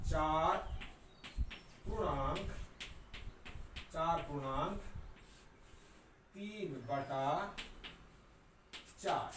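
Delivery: चार पूर्णांक तीन बटा चार read word by word with long pauses; slow reading aloud. Shown as a demonstration. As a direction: spell out चार पूर्णांक तीन बटा चार slowly, pausing after each word. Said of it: "ऐसे पढ़ेंगे". (चार पूर्णांक तीन बटा चार को धीरे-धीरे, रुक-रुककर पढ़िए)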